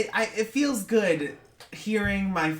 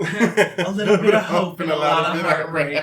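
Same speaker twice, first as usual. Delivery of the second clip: country singer; 8 dB louder than usual. Retold or imitated. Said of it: "Sounded like a country line".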